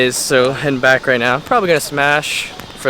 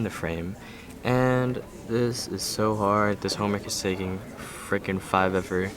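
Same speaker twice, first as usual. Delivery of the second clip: hushed, low-level murmur; quiet voice; 4 semitones lower, 9 dB quieter than usual. Real, not acted. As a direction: keep it down, half under breath. Filming quietly while doing homework.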